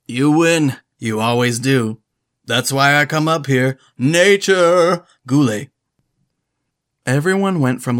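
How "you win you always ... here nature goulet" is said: loud, booming crooner yell; brash showman bellowing it out; booming [name] voice. Acted. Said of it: "my best impression of Will Ferrell as [name]".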